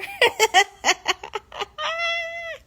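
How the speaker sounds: high cackling laugh; real laughter. Real, not acted. Cracking up retelling the prank, real laugh. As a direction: burst out laughing.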